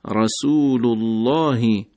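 رسول اللهی with a thick, full lam in الله, slowly; full heavy lam. Shown as a demonstration. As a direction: give the lam of الله a heavy, rounded weight.

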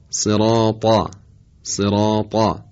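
slow «صراطا» with an emphatic ط; تلفظ درست ط. Shown as a demonstration. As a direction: draw out «صراطا», ending on a heavy velarized T. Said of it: "درستش هست". («صراطا» کشیده با طای مُطبَق)

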